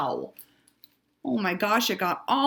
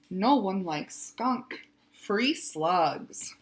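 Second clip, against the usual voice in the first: usual speaking voice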